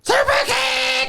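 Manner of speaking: high, slow excited yell; excited shout. Real, not acted. Calling the move.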